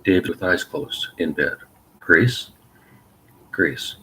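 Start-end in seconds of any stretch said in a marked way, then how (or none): 2.0-2.5 a loud rising call of the name. Acted. David calls out to Grace from bed, a loud rising call. Calling out to wake partner.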